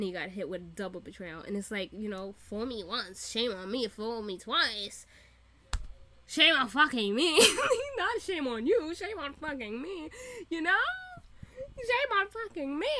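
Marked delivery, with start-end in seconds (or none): 6.3-12.9 loud, worked-up outburst, pitched up; exasperated self-blame. Real, not acted. Fan venting over being fooled twice, exasperated. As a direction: hammer it out in comic exasperation.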